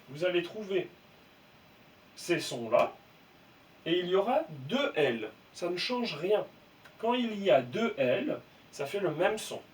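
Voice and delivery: ordinary talk, nothing marked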